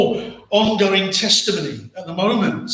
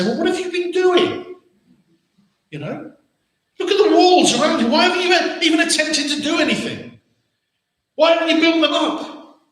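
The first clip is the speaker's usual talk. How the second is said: higher, faster scolding questions; scolding rebuke; 9 semitones higher than usual. Retold or imitated. A newcomer scolds the people over the walls, scolding. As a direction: scold them for the ruined walls. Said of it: "you'd expect him to come and say".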